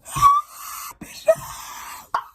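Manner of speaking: airy dramatic high gasp; sharp gasp. Real, not acted. Hearing only the teacher is on duty, shocked gasp. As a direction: gasp in horror.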